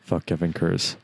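soft, seductive murmur; seductive whisper. Pretend. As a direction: breathe it low and seductive. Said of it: "very seductively said".

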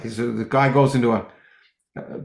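ordinary talk, nothing marked

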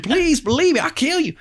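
high squeaky pleading voice; mocking the suspect with a high squeaky plea. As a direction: plead in a silly falsetto.

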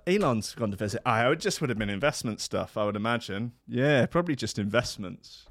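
ordinary delivery

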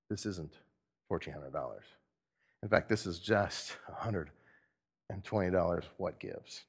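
low, hushed disbelief; let-down worker. Acted. Worker opening the envelope at pay time, quietly let down. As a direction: say it small, as if puzzled by what you see.

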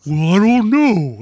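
slow, loud, high-pitched cartoon doll voice; dopey claymation-style character drawn out slowly; Goofy Goggles. Podcaster imitating the new doll from the episode, goofy cartoon voice. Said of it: "that's my Goofy Goggles impersonation".

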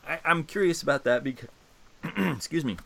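choking a little mid-sentence. Real, not acted. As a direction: speak through a tickle in the throat. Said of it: "Oh man, choking".

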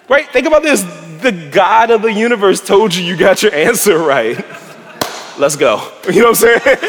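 high, loud and laughing in delight; laughing amazement. Real, not acted. Preacher to congregation, loud laughing amazement. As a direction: marvel out loud, laughing at how good it is. Preacher marvels at God's answer.

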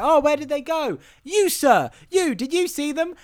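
high-pitched acted urgency; hammed-up, high frantic appeal; frantic questioning. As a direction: call out to passers-by in mock urgency. Acting out character frantically asking passers-by.

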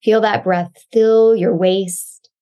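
normal talking voice

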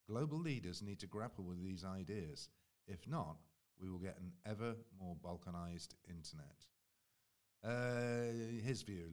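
ordinary talk

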